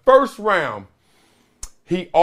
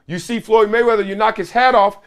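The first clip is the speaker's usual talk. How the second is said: ordinary delivery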